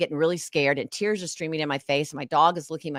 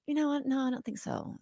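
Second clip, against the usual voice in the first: lightly mocked high, soft brush-off; a hint of a smug ex turning her down; Alec's refusal; 9 semitones higher, 9 dB quieter than usual. Ex refusing to lend money, mock high polite brush-off. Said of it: "And he looked at me and said".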